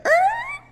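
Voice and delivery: sharp high strangled yelp; a shocked squeak as the body locks up; tiny high startled yelp; startled freeze cry. Retold or imitated. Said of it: "the demon came out and my fucking whole body was like".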